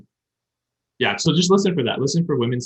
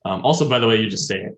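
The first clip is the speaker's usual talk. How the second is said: normal talking voice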